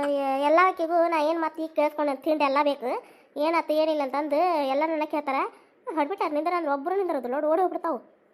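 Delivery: normal talking voice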